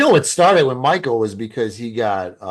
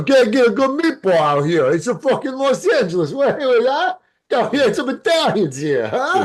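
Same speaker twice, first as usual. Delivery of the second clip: much higher mock Italian New Yorker; Italian accent; 11 semitones higher than usual. Comic griping about Los Angeles meatballs, thick mock Italian accent. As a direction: ham up an Italian complaining. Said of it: "if I did the Italian version".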